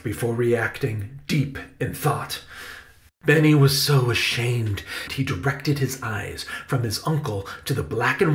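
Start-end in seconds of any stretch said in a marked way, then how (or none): none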